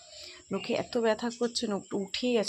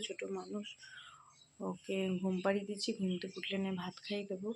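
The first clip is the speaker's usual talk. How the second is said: speaking softly; 9 dB quieter than usual. Real, not acted. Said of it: "তার জন্য আস্তে আস্তে কথা বলছি".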